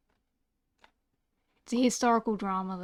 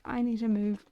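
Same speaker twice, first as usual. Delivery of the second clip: normal talking voice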